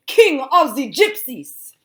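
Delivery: loud, high, slow proclaiming; grand proclamation. A narrator's reading. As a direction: announce him like royalty. Teacher proclaims Ron's title.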